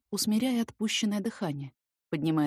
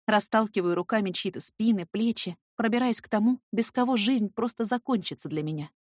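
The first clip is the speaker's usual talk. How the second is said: usual speaking voice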